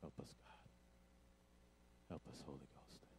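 breathy, very soft whispered plea; hushed whisper of a prayer. Real, not acted. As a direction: pray under your breath to God. Quiet prayer before reading scripture.

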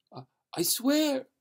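high, trembling vow; terrified oath. A narrator's reading. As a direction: swear it in sheer fright. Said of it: "said Aubrey".

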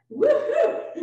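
high, loud woohoo; cheering. Real, not acted. Cheering thanks to Stephanie.

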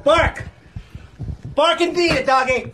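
high mocking command; mocking dog owner. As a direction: talk to him like a dog. Commanding slave boy.

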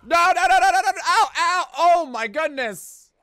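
high, loud rapid nos and ows; panicked yelping. Real, not acted. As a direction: yelp as you are attacked. Being attacked in game.

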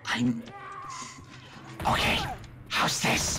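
breathy unvoiced whisper. Real, not acted. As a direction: whisper it right into the mic. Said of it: "I need to whisper".

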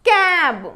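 "క్యాబ్" high, long vowel, falling; pronunciation demo. Shown as a demonstration. Teacher modelling the blended word, "క్యాబ్" high and drawn out. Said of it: "ఎలా పలుకుతుందో చూద్దాము".

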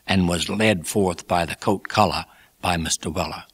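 plain reading voice

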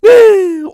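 loud, high startled gasp; sharp gasp. Acted. Villager gasps as they reach the village gate, shocked. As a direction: suck in air in shock.

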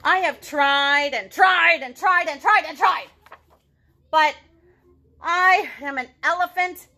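loud, slow, exasperated lament; frustrated Gerald. Acted. Gerald tells Piggy he will never dance, exasperated. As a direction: pour out the hopeless frustration.

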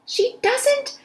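high, slow indignant cry; indignant shout. Acted. Eugenia shouting back at Baby, high and indignant. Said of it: "shouted Eugenia".

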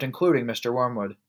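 usual speaking voice

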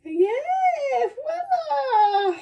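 high laughing cry; triumphant squeal. Real, not acted. Triumphant jar reveal.

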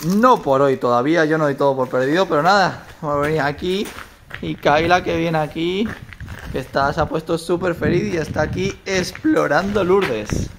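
ordinary delivery

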